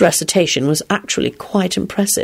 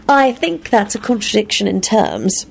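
higher-pitched, matter-of-fact objection; practical; 5 semitones higher than usual. A narrator's reading. Elizabeth correcting Lupin's logic, practically. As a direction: counter with brisk common sense. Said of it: "countered in her practical voice".

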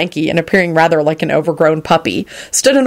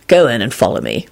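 low, firm instruction; 6 semitones lower than usual. A narrator's reading. Lupin sending Quentin into the store, firm. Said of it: "Lupin instructed Quentin firmly".

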